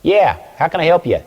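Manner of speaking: high, brisk New York phone answer; guy from New York. Acted. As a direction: answer like an impatient New Yorker. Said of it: "some guy from New York".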